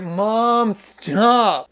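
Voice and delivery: high, drawn-out mock whine; hammed-up teenage-style protest; embarrassed son. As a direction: whine at mom to quit it. Acted embarrassed son answering mom.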